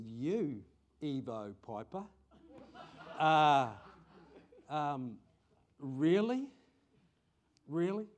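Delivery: rising, incredulous laughing really; surprised disbelief. Retold or imitated. Pastor retelling his shocked reply to a friend, incredulous. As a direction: gasp out your disbelief at the news.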